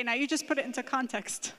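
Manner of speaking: normal talking voice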